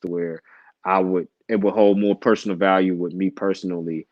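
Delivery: ordinary talk, nothing marked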